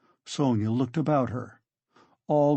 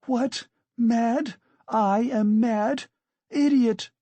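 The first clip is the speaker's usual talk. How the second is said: very high, outraged shriek; 10 semitones higher, 4 dB louder, much slower than usual. A narrator's reading. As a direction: fling it back, high and furious. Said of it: "shrieked Katerina Ivanovna".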